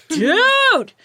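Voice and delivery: loud high dude; calling out. Shown as a demonstration. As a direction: shout for attention. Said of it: "I say dude a lot".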